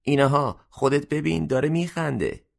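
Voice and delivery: high, astonished cry; surprised exclamation. A narrator's reading. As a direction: point it out in amazement. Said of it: "با تعجب فریاد زد".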